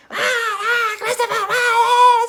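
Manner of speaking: very high, frantic mock scream of a worried mum. Worried mum screaming at her son coming home, hammed-up shriek. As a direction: shriek the name like a panicked mother. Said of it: "screaming, screaming, screaming".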